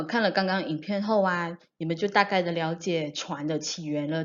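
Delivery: ordinary delivery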